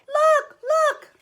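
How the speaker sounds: put-on very high, loud baby bear cry; wee little voice. A narrator's reading. Baby Bear calls the older bears to look, wee squeal. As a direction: squeal it urgently. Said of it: "he cried in his wee little voice".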